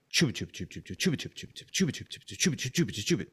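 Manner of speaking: quiet rhythmic chugging chant; chugging train chant. Pretend. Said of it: "sound like a train".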